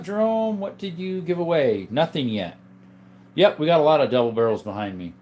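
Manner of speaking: ordinary talk, nothing marked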